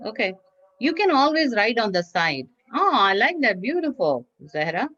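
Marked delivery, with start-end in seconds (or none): none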